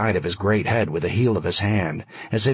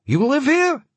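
put-on high, loud voice, light Yiddish accent; slight Yiddish accent; 4 dB louder than usual. A narrator's reading. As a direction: press him in a high Yiddish-tinged voice. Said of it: "slightly Yiddish accent".

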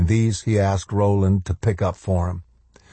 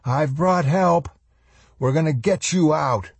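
calling out; 9 semitones higher than usual. A narrator's reading. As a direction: call down to reassure the trapped man. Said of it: "he called".

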